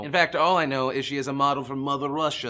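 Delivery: hammed-up fake Russian accent; bad Russian accent. Vlogger introducing a Russian model in a hammed-up Russian accent. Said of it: "this is probably terrible Russian accent".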